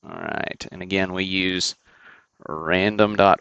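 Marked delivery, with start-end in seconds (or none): none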